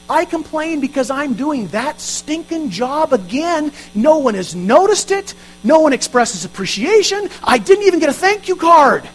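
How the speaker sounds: hammed-up whining complaint, rising in pitch; mock complainer. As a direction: mimic a self-pitying servant grumbling. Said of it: "I complain because I think".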